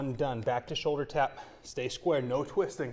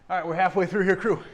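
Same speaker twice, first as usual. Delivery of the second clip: calling out; 5 semitones higher, 8 dB louder, faster than usual. Real, not acted. Calling out to the class.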